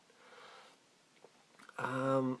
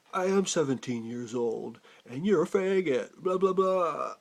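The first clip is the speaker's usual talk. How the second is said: angry man mocked; 5 dB louder than usual. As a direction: throw back a childish taunt, imitating him. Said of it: "And he said".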